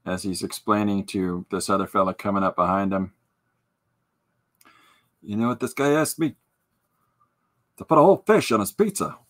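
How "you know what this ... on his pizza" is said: exasperated cartoon guy. Cartoon character complaining.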